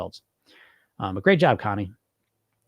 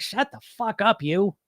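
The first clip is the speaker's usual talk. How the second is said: telling off inner voice; 6 semitones higher than usual. Acted. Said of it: "we all got that voice in our head".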